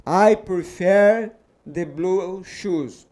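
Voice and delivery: slow, careful English reading for the class; slow, clear teacher's model sentence. Shown as a demonstration. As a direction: read it out word by word like a teacher.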